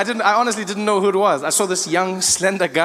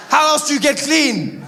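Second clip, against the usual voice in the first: ordinary delivery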